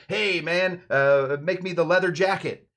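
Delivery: loud, high hail to the bartender; hammed-up bar order; mock customer call. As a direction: holler an order like an obnoxious regular. Acts customer calling out an order.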